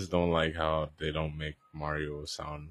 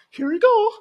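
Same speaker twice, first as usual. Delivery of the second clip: very high, loud catchphrase squeal; imitating an over-the-top high-pitched catchphrase; Mario; 13 dB louder than usual. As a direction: hop suddenly into a squeaky cartoon cry. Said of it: "that he talks like that".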